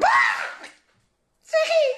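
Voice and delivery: high shocked yelp of surprise; shocked cry. Retold or imitated. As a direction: gasp out the name in shock. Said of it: "C'est comme". (cri de surprise choqué, très aigu)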